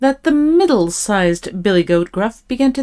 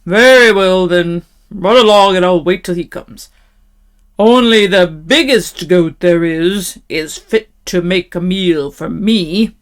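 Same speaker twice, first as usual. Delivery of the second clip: terrible troll voice; 5 dB louder than usual. A narrator's reading. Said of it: "in his great, terrible voice".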